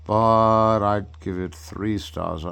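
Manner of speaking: loud, slow mock pronouncement; Captain Greenbeard. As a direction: boom it out like a pompous critic. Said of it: "Captain Greenbeard would say".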